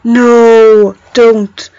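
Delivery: loud, drawn-out protest on each word; emphatic refusal. Retold or imitated. Reacting to English people saying grand, loud emphatic refusal. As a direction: plead firmly against it, one long word at a time. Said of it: "I was just like".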